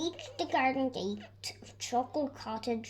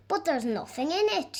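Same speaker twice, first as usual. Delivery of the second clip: higher, louder surprised exclaim; 6 semitones higher, 4 dB louder, faster than usual. A narrator's reading. Miss Giggles finding the box empty, higher and louder. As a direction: blurt out the surprise. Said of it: "she exclaimed".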